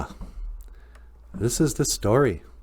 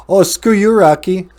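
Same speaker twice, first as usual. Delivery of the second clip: put-on sassy girl retort; 10 semitones higher, 10 dB louder than usual. Girl snaps back at Rocky.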